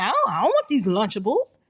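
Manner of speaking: lightly voiced whiny kid; a hint of a pouting child; five-year-old. A five-year-old refusing lunch, lightly whiny. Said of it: "a five-year-old saying".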